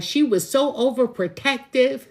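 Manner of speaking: high, loud mimic of grown children complaining; mocking her kids. Mother mimics her children calling her overprotective. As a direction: whine it like a grown child blaming mom. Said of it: "They'll be like".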